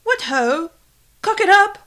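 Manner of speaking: a silly British bloke; mock British accent. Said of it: "speak like a Brit".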